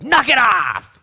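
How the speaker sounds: loud, high barked scold; stern scolding. Pretend. Pastor imagining Jesus scolding the disciples, loud bark. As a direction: snap it like a fed-up parent. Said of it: "He could have showed up and said".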